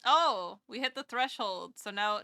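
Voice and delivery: ordinary talk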